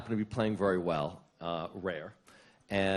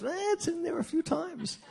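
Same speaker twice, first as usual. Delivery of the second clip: much higher, sly mock voice with a giggle; teasing friend; 4 dB quieter than usual. Imitating a teasing friend on the phone, high with a giggle. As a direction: squeak it out slyly, then titter. Larry's teasing answer with laugh.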